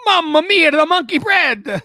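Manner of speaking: loud, high, drawn-out Italian caricature; Italian accent. Retold or imitated. Co-host joining in on the toddler impression, hammed-up Italian accent. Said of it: "have an Italian accent".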